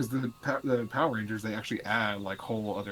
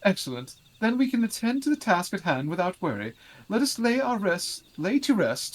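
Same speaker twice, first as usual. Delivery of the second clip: pompous voice; 11 semitones higher, 8 dB louder than usual. Acted. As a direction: play a haughty noble, grandly. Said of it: "the pompous voice".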